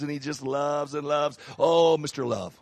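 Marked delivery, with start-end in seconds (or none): none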